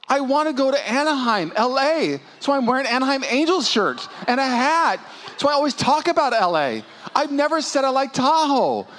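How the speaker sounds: high complaining wife voice; imitating the wife. Wife explains in retold story.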